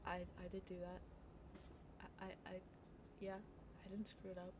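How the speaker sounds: a quiet, caught-off-guard admission; stunned and deflated. Retold or imitated. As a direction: mumble it like the fight just drained out. Stunned after boss's praise.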